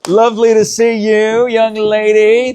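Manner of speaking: high, loud delighted welcome; gushing greeting. Real, not acted. Host greets arriving guest.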